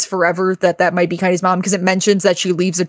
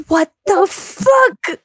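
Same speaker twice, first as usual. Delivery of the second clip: much higher, slow "what the fuck"; drawn-out shock; 10 semitones higher, much slower than usual. Retold or imitated. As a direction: draw each word out, baffled. Player reacting to game twist.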